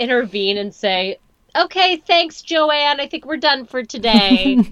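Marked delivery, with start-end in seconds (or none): 1.6-4.6 high, bright fake politeness; mock dismissal. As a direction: show her the door sweetly.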